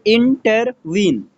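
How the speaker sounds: Intervene slowly split into three beats, इन-टर-वीन; syllable by syllable. Shown as a demonstration. Teacher giving an anapest example, intervene slowly syllable by syllable. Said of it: "दो unstressed syllable होगा और एक stressed".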